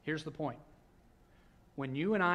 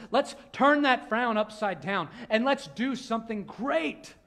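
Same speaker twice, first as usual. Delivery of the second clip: high, slow, louder cheerful voice; upbeat encouragement; 7 semitones higher, 8 dB louder than usual. Retold or imitated. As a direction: coax them like a cheerful friend. Voicing God's cheerful encouragement.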